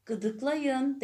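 high, loud order to tickle; tiger's command. A narrator's reading. Tiger ordering the animals to tickle the elephant, loud. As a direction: bark it like a cheerful boss. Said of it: "dedi kaplan".

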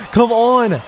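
ordinary talk

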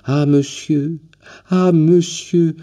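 put-on an old man too moved to find words; stammering with joy. A narrator's reading. Old man stammering to the visitor, overwhelmed with joy. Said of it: "il bégayait".

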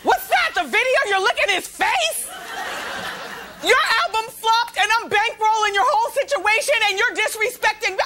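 high, laughing mock outrage; mock angry rant. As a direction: ham up a jealous boyfriend. Voicing [name] angry, high.

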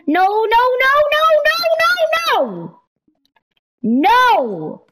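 rising high no no no; escalating loud panicked no; frantic high protest; 1 panicked shout. Real, not acted. Watching something go wrong.

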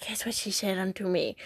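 breathy teasing whisper. Real, not acted. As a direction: hush it like a secret. Teasing build-up to a secret.

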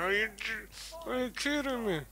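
high-pitched outraged disbelief; raging gamer. Real, not acted. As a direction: shriek it in disbelief. Outraged at the game.

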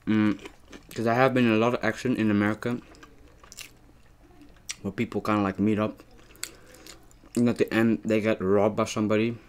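speaking between bites; talking while chewing. Real, not acted. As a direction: mouth partly full of food. Seen 1: Bites burger at -1.0; lips pressed, mouth working while he talks.

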